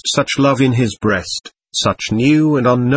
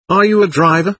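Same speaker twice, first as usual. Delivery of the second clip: pitched higher and a little louder, frantic; 7 semitones higher, 3 dB louder, faster than usual. A narrator's reading. Mitya grabbing the driver's shoulders, frantic and higher. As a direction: blurt it out in a frantic rush. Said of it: "he asked frantically".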